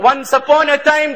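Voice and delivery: ordinary talk, nothing marked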